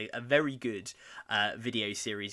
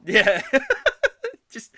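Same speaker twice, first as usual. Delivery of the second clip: loud high laughter; laughing; 14 dB louder than usual. Real, not acted. Host laughing at betrayal joke, loud. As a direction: crack up loudly.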